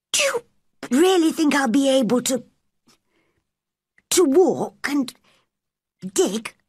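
put-on high boy's voice, halting with pauses; hesitant, hopeful boy. A narrator's reading. Invalid boy hesitantly hopes to walk.

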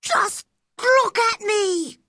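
put-on very high, stretched-out boy's yell; insistent shout. A narrator's reading. Boy insists the gardener see him stand, insistent shout. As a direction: draw each word out in a high shout. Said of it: "he shouted at Ben".

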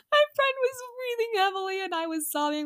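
high, loud words through laughter; half-sob with laughter; laughing hard. Real, not acted. Reading her old line, laughing hard with high squeals.

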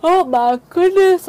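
real yawn swelling through the words, high and loud. Real, not acted. Player apologizing to viewers for tiredness, yawning. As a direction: stretch it out slowly through a yawn. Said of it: "I keep yawning".

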